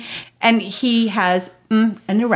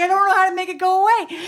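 imitating a panicky toddler, high and quick; mock whine, pitched up; upset little boy; 7 semitones higher, faster than usual. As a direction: rush it out like a distressed child. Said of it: "He's really upset".

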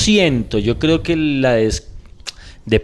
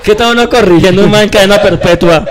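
laughing, higher and louder question; 5 semitones higher, 5 dB louder than usual. Real, not acted. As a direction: laugh through the absurd image. Laughing at the absurd idea. (pregunta entre risas, aguda y fuerte)

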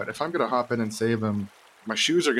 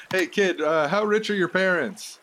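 calling out; 8 semitones higher than usual. Pretend. Calling to drowning kid.

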